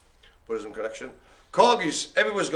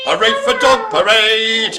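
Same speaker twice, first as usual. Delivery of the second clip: cheer; 7 semitones higher, 8 dB louder than usual. Acted. Cheering a dog parade.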